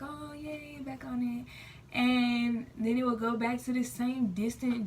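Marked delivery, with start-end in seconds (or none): 0.0-1.5 mock cheer. Mock cheer about the relationship.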